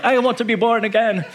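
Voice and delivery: high-pitched, gushing mock eagerness; mock eager convert. Listener won over by an accented preacher, hammed-up eagerness. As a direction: beg to convert in a comically high voice.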